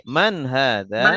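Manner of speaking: Man hadza said slowly, rising like a question; slow it down for them to repeat; slow model phrase. Shown as a demonstration. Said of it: "Coba diikuti". (Man hadza diucapkan pelan untuk ditirukan)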